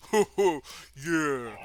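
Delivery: big oaf's gleeful hoot; Boris. Acted. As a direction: hoot like an eager brute. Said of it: "And Boris goes".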